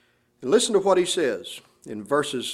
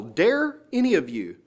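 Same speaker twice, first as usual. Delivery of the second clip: "Dare" lifted and leaned on at the start; "Dare" hit first and highest; stress on dare; 3 semitones higher than usual. Shown as a demonstration. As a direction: open hard on "Dare", then let the phrase fall. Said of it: "the word dare is the very first word".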